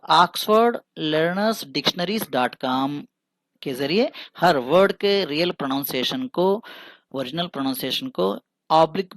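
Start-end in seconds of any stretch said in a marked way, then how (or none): 0.1-3.0 very slow, spelled-out website name; teacher slowly reads out the site; slow dictation. Shown as a demonstration. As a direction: dictate the address word by word. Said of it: "Oxford advanced".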